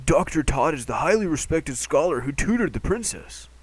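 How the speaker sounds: lightly voiced old scholar; a hint of an elderly tutor. Voices Doctor Tot.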